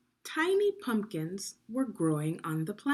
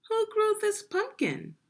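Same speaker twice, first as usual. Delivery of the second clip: much higher, wondering king's question; the king asks in amazement; curious king; 4 dB louder than usual. Acted. King asks about the pumpkin.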